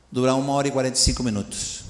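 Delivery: ordinary talk, nothing marked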